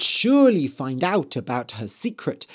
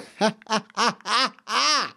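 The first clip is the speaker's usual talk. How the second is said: wicked queen's cackle; evil cackle; 7 semitones higher than usual. A narrator's reading. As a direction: cackle like a villainess.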